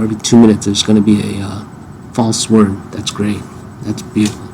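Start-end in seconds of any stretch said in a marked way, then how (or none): 2.9-4.5 dry, sarcastic praise. Real, not acted. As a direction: say it sarcastically, deadpan. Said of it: "I'm being sarcastic".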